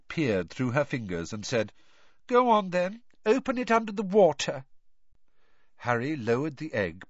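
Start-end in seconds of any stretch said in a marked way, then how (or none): 2.3-4.6 put-on high, whiny girl ghost; Myrtle's ghost voice. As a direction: pitch up into a mopey schoolgirl ghost.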